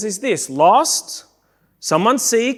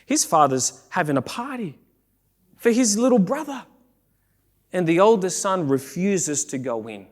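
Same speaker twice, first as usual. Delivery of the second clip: ordinary delivery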